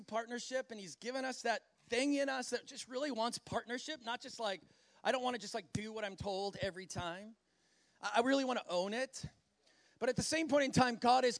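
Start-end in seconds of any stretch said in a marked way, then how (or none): none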